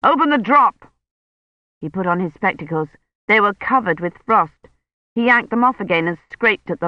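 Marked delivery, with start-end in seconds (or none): 0.0-0.9 higher, urgent narrated shout; contained shout, high and insistent; shouted order. A narrator's reading. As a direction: call out a command, pitched up. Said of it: "he shouted".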